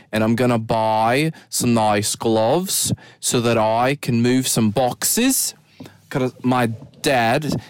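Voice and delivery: slow, effortful Australian accent with rising phrase ends. Shown as a demonstration. Host telling listeners about dad's storage unit, Australian accent. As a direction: put on a drawn-out Australian accent, lilting upward. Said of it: "let's try and transition into the accent".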